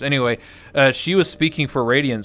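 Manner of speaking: ordinary talk, nothing marked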